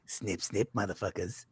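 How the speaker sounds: low, soft mock Australian accent; a hammed-up Australian accent, low and soft; bad Australian accent. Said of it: "That was a horrible impression".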